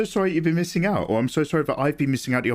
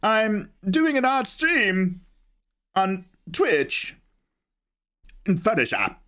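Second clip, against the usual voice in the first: higher, slow, oddly spaced Walken-style phrasing; Christopher Walken; 6 semitones higher, slower than usual. Retold or imitated. Streamer doing a higher, slow Walken impression for chat. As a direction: stretch each phrase out with odd pauses. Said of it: "We've got Christopher Walken".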